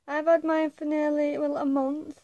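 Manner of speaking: ordinary talk, nothing marked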